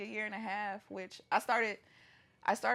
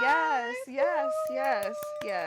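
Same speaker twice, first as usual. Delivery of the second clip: excited; much slower than usual. Real, not acted. Celebrating returning to private practice.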